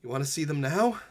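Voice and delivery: slow, hushed scene-setting narration; game master narration. Acted. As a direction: draw the players into the scene slowly.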